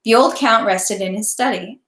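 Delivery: ordinary delivery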